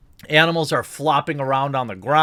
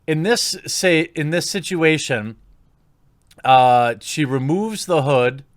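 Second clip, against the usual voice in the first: ordinary delivery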